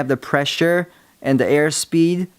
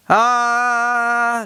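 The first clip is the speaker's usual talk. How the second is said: long high held ah, shouted; screamed ah; 9 semitones higher than usual. Shown as a demonstration. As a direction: belt out a flat ah.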